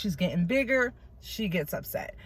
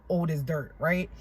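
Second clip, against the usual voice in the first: ordinary delivery, nothing marked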